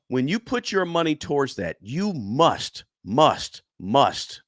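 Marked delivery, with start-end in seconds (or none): 1.8-4.4 must, must, must hammered out one by one, each must punched; overly stressed must. Shown as a demonstration. Urging retail investors about their 401k, stressing each must. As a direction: lean hard on every must, pausing between. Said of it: "I'm overly stressing the word".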